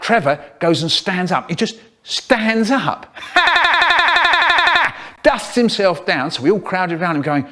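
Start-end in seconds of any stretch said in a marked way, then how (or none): 3.2-5.2 high laugh. Real, not acted. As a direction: burst of high giggling. Kid laughs at the story.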